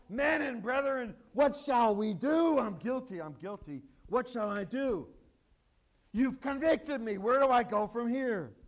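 loud, pleading cry of the guilty; desperate, loud confession; anguished cry. Acted. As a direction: beg for help as the convicted. The elect crying out.

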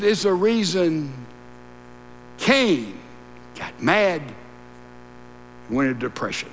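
normal talking voice

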